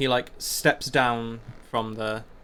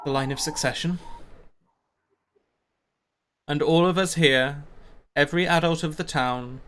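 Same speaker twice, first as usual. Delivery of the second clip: measured public speech, raised in pitch, with long pauses; leader addressing townsfolk; 4 semitones higher than usual. Acted. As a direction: address the whole crowd, pausing between phrases. Caster addresses the townsfolk at the meeting.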